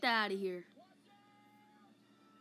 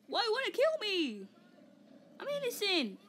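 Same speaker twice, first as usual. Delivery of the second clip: high, whiny mock plea; pleading protest; 9 semitones higher than usual. Play victim pleading with the attackers, high mock whine. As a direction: plead innocence in a high-pitched wail.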